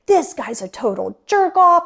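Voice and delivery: high-pitched, indignant retold outburst; retold angry yelling. Angry ex-wife venting to ex-husband, retold yelling. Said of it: "Like, I was yelling".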